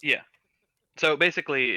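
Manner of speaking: usual speaking voice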